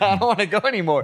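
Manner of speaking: high, fast mock whine; acted nervous babble; scared protest. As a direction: plead to back out, imitating a panicky friend. Friend scared on the ledge.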